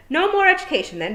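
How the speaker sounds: high, loud sudden outburst; a witch cutting the lesson short, loud and high; sharp exclamation. A narrator's reading. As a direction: snap the line out high and loud. Miss Tick sharply changing subject.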